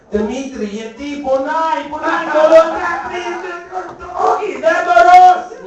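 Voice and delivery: high voice climbing into a shout; hammed-up pained pleading. Teasing Greek buddies about pain, escalating high mock wail.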